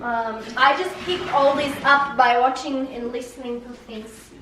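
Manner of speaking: Russian-accented English. Shown as a demonstration. Child tells how she learned it, Russian accent. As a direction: explain it all in a Russian accent. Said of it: "the Russian accent".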